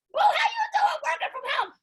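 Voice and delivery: sky-high sing-song gloating taunt; mocking taunt. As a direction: crow it at the doubters, way up high. Taunting former doubters.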